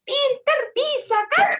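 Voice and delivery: put-on high childlike praise; child voice. Storyteller praises the group, still in high child voice. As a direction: praise them in a tiny kid voice.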